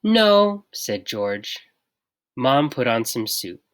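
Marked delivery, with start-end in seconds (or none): 0.0-0.7 firm no. A narrator's reading. George firm reply.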